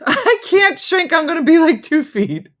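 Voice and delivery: mock wail. Reacting to talk of getting shorter, mock wail. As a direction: wail it in hammed-up dismay.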